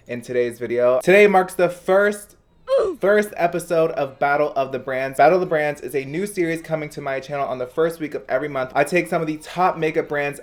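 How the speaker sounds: bright, loud presenter energy; high-energy YouTuber host read; upbeat vlog intro. Acted. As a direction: hype up the new series for viewers.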